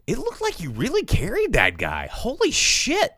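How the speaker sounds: high, swooping disbelief; awestruck, high-pitched exclaiming; amazed reaction. Retold or imitated. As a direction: re-enact being blown away, pitch leaping up. Acting out own amazed reaction.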